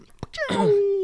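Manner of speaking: high, loud bullet-ricochet whine; imitated ricochet. Shown as a demonstration. Shooter illustrating steel bouncing bullets back, imitated ricochet. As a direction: make a whining ricochet noise.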